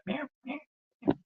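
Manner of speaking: high soft meowing; cat meow. Pretend.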